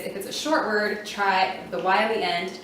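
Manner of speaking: usual speaking voice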